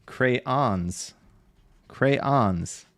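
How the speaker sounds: Crayons as two slow syllables, kray-ons. Shown as a demonstration. Streamer trying the way chat says it, Crayons as cray-ons. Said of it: "you guys said I say it like brains".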